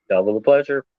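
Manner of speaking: low, lightly voiced twin brother; deep twin voice. Shown as a demonstration. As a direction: drop the pitch for the twin. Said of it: "That's my twin brother".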